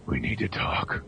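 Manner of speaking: low, hushed whisper; shadow's voice. Acted. Shadowy figure addressing Superman from behind, whispering. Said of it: "the voice says".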